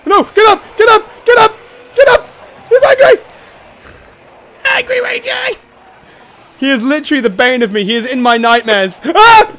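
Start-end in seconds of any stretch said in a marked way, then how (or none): 0.1-5.5 very high, loud squeal; panicked pleading. Real, not acted. Player knocked down before angry monster, panicked squeal. 9.0-9.5 short, high, loud scream; startled scream. Real, not acted. Player surprised by his nightmare monster, startled scream.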